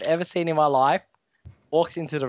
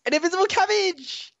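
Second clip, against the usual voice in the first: put-on very high girly punchline; cutesy girl voice. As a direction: deliver the joke in her sweet squeaky falsetto. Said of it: "And she's like".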